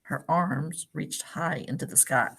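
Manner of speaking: gentle storybook read-aloud; picture-book narration for a class; reading to children. Acted. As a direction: read it like story time for little kids.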